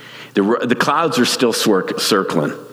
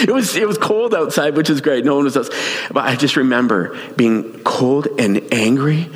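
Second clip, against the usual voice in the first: usual speaking voice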